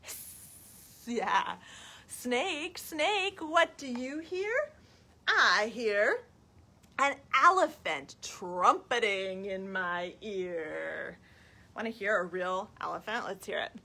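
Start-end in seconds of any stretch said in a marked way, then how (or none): 0.0-0.4 long, soft sustained hiss; snake hiss. Shown as a demonstration. Inviting children to hiss along, soft hiss. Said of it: "Can you hiss?". 2.3-11.2 put-on reading to children. Storytime question and answer about an elephant, lilting. Reads picture book to children.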